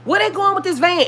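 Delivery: put-on high, alarmed cry of an old lady; old lady crying out to warn the mother; retold scream. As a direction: call out in alarm, pitch way up. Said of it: "she screamed out and was like".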